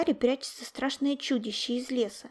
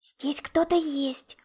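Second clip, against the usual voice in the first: high, slow, hushed fright; scared, drawn-out stage whisper; frightened; 4 semitones higher, 3 dB louder, much slower than usual. A narrator's reading. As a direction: warn a friend that you are not alone. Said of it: "испуганно сказал Яша".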